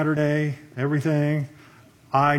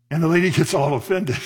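usual speaking voice

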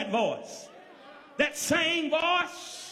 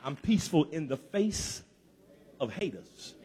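very low, husky preacher's pleading; fervent prayer; 14 semitones lower, faster than usual. Real, not acted. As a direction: plead from deep in the chest, letting it grow hushed. Leads congregation in prayer, then testifies.

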